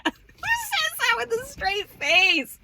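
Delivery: very high, loud, drawn-out squeal of disbelief; incredulous shriek. Real, not acted. Daughter reacting to mom's bluntness, shrieking in disbelief. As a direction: screech it in outraged amusement. Incredulous outburst at mother's remark.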